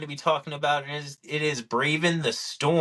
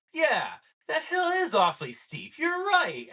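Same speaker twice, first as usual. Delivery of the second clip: high, overly sweet agreeable voice; mock agreement. Golfer to his caddy, mock agreeing high-pitched. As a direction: play along in a mock innocent tone.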